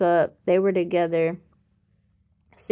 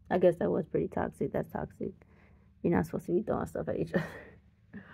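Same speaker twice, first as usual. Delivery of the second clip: whispered aside; 8 dB quieter than usual. Real, not acted. Quiet aside on parents' fights.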